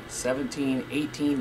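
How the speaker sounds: usual speaking voice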